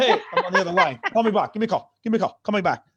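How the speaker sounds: hurried dad, laughing through it; dad brushing off. Retold or imitated. Dad on the other line to his son, brusque. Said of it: "He's like".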